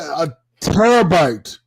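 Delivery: "terabyte" drawn out, high and loud; emphasis on terabyte. Real, not acted. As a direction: hammer "terabyte" slowly for comic effect. Repeats the word for comic emphasis.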